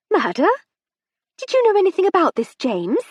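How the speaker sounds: wife turns on her husband, sharp and shrill. A narrator's reading. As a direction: snap the question at her husband. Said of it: "she asked her husband sharply".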